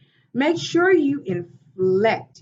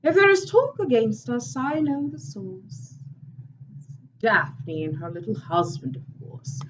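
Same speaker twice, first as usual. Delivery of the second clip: higher, sharper gossip-laden speech about Daphne; dramatic monologue; 5 semitones higher, 3 dB louder than usual. Acted. Teacher performing a monologue for the class, theatrical and scornful. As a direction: play it as a scornful stage character. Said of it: "I want to present a monologue".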